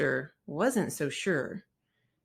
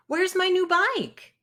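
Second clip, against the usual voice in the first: Brother's alarmed cry; 13 semitones higher, 5 dB louder than usual. Acted. Brother finds bike gone.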